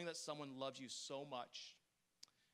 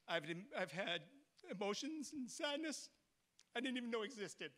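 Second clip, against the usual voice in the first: ordinary talk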